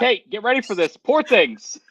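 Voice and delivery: high, loud teasing reveal; teasing announcement. Retold or imitated. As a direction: tease him like a game-show host. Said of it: "I was about to say".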